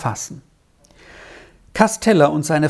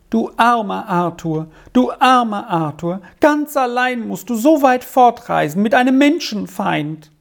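higher-pitched, lamenting outcry; pitying exclamation; 7 semitones higher than usual. A narrator's reading. Squirrel pities Arthur leaving alone, pitying outcry. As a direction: wail with pity for him. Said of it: "Sie musste einmal ums andere ausrufen".

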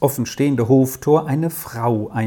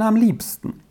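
high; 9 semitones higher than usual. A narrator's reading. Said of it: "entgegnete der Major".

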